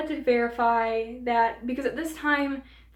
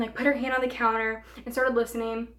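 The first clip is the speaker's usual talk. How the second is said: ordinary talk, nothing marked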